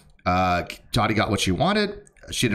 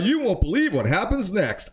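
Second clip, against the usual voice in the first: clickbait announcer voice; 10 semitones higher than usual. Retold or imitated. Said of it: "I don't usually do this sensational stuff".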